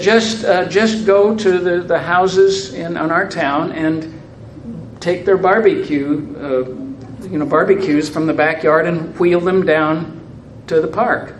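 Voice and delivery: easygoing Newfoundland local giving directions; Newfoundland accent. Retold or imitated. Townsfolk tell the New Yorker to take the barbecues, in a light Newfoundland accent. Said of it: "I'll lapse out of Newfoundland".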